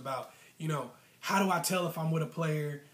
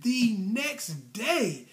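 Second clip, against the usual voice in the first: "the next day" stretched very slow, high and loud; drawn-out, higher-pitched stress on "the next day"; emphatic repeat; 6 semitones higher, 5 dB louder, much slower than usual. Retold or imitated. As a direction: lean hard on NEXT and DAY.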